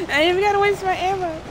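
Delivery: squealing with glee; gleeful high boast. Real, not acted. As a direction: crow it out, high and loud.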